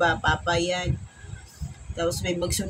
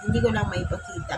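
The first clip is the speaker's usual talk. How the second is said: ordinary talk, nothing marked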